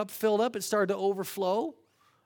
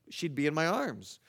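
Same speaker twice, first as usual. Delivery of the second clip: normal talking voice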